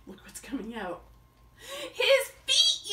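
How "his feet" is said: much higher, loud yell; shouting in fright. Acted. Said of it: "yelled Dakota".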